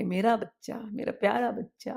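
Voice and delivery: put-on a mother cuddling her child; tender mother. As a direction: croon to a child on your lap. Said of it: "माँ उसको गोद में लेकर के".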